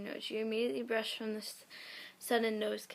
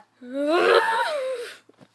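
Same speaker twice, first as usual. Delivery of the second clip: high loud cracking laugh; a loud laugh breaking out of the shout; shrieking laugh; 11 dB louder than usual. Real, not acted. Reading a fanfic aloud, bursting into a loud high laugh. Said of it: "laughing shout after".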